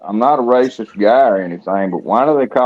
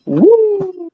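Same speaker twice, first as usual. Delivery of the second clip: Ric Flair woo. Retold or imitated. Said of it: "As Ric Flair would say".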